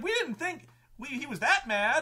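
high, half-laughing mock dismay; retold players' protest. Players to game master about Tempest, high mock dismay. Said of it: "And they're like".

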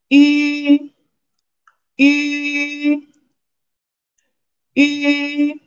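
yu in a high, level tone; high tone demo. Shown as a demonstration. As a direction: hold "yu" high and flat. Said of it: "Second one".

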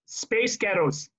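"space ghettos" slow, then run together. Shown as a demonstration. Coach showing players how it blurs together. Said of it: "saying it a little bit quicker".

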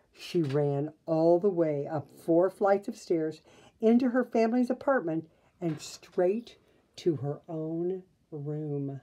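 ordinary delivery, nothing marked

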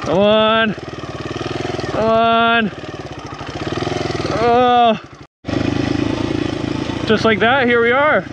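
ordinary talk

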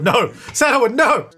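mock-panicked protest, pitch jumping up; half-serious dismayed yelp; alarmed calling out. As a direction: cry out to stop them, playfully. Calls out to stop game character.

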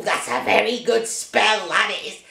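low witch voice, pleased with herself; smug witch croon; gloating witch. Acted. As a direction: purr it like a proud witch. Witch praising her own spell.

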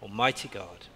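ordinary talk

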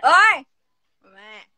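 loud thuggish bark; yankee thug voice. Pretend. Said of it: "ヤンキー".

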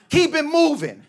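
high mock menacing warning; tough guy warning. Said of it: "he goes".

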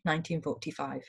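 ordinary talk, nothing marked

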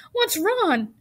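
high, startled announcer's question; questioning loudspeaker. A narrator's reading. Loudspeaker asking about fainted Wilbur, high questioning call. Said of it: "asked the loudspeaker".

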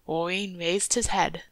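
plain reading voice

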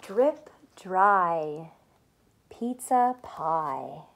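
ordinary delivery

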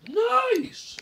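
high squeaky nice. Acted. Silly high exclamation.

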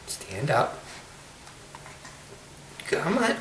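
ordinary talk, nothing marked

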